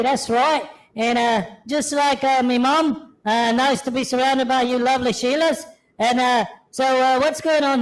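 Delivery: easygoing Aussie kangaroo voice; laid-back Australian twang, introducing himself; Australian accent. Acted. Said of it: "I love the Australian accent so much".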